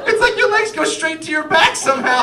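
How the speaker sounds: high, loud voice breaking up with giggles; helplessly amused, mocking through laughter; laughing wife impression. Wife teasing husband about his body, laughing hard.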